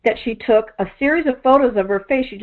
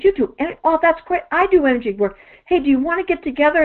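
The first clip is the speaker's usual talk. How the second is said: imitating her excited, higher gush; retold excitement; 7 semitones higher than usual. Lady at the expo finding another energy worker, excitedly higher. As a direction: gush like a thrilled new friend. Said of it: "She was so excited".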